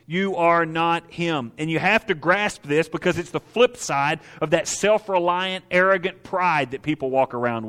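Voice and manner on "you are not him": very slow, heavy words; slow emphatic. Acted. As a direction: lay each word down slowly. Sermon emphasis.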